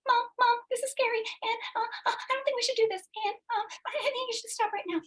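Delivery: put-on high, pleading child tugging at mom; whiny little kid. Imposter monster Good Girl begging mom to stop, high childlike whine. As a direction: whimper it like a scared kid pulling at a skirt. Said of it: "she's like this little kid that's constantly tugging on my skirt".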